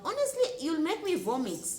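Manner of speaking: disgusted outburst. Real, not acted. Vlogger telling guests their chewing makes her sick, disgusted. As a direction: spit it out, truly grossed out.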